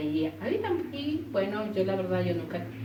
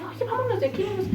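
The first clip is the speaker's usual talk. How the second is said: high, frantic plea to leave; imitating a panicked friend begging to go; desperate cry; 13 semitones higher, faster than usual. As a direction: retell her panic in a pitched-up cry. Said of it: "me comienza a gritar y a gritar y, y así desesperada".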